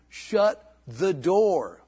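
drawn-out "Shut the door" in a put-on voice; mock wife command. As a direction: slowly mimic the wife's order. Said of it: "My wife had to say that to me".

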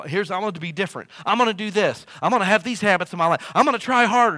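building from eager to loud and high; mock eager resolution. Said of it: "people leave Sunday and go".

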